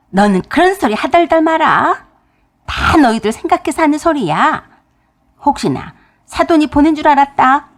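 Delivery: retold yell. Mother-in-law yelling at her daughter-in-law, loud and high. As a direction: snap at her sharply, voice up and loud. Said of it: "소리를 빽 지르셨어요".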